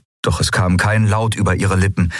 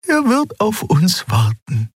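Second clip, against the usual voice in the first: higher, reassuring companion voice; Xea's voice; 12 semitones higher, slower than usual. Acted. Xea reassures Endriel in a higher voice. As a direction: comfort her in a higher voice. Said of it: "sagte sie".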